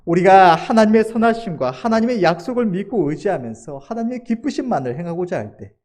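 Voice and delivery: ordinary talk, nothing marked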